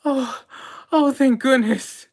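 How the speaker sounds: high, loud gasp of relief; relieved researcher. Acted. Researcher in a lab coat greets the rescuers, high and loudly relieved. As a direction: sound rescued at last, almost weeping with relief.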